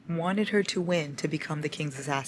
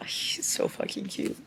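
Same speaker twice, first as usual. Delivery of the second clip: gushing whisper; 6 dB quieter than usual. Real, not acted. Reader whispering about her crush, slow.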